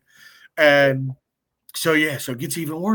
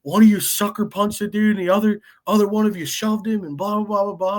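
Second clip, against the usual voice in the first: mocking cop; 7 semitones higher than usual. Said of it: "He's like".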